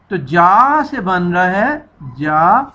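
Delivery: ordinary delivery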